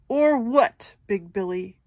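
mock the goat; sneering troll. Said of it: "says Troll".

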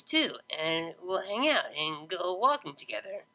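rambling tipsy voice; drunk. A narrator's reading. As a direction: slur it like a happy drunk. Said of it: "have you been drinking".

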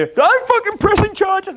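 slow, very high outraged howl; mock wailing scream. Floored client threatening charges, high mock wail. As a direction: imitate a drawn-out screamed threat. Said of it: "he's just wailing and screaming".

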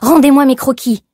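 loud, high furious demand; angry cry. A narrator's reading. Young woman demanding her sketches back, angry cry. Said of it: "s'écria-t-elle".

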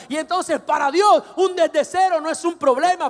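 loud, high, mounting declaration of faith; full-force preaching. Real, not acted. Preacher declaring no fear of starting from zero, impassioned shouting. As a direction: belt it out with conviction, building.

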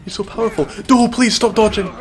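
frantic surprise mid-fight; excited alarm. Real, not acted. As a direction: yelp at the strange boss.

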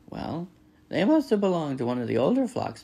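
lightly voiced opinionated goose. A narrator's reading. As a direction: reason briskly as a bossy goose. Goose reasons aloud.

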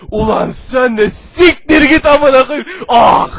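slow, furious bellow; angry shout. Pretend. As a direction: curse him out loudly. Character quarrel, shouted.

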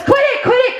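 high, loud retold shriek; panicked yell. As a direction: yelp it to stop a friend. Retold friend begging her to stop.

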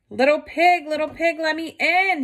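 a hungry wolf demanding at the door; growling wolf. Acted. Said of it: "he growled".

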